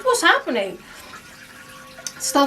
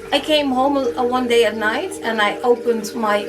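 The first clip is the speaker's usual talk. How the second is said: ordinary delivery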